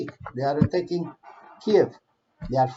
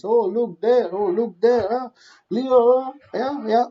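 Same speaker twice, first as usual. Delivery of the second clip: higher, louder teasing "Oh, look there"; mocking Putin taunt; 5 semitones higher, 4 dB louder than usual. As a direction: taunt the fool you tricked. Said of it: "mocking Putin distracting Leo".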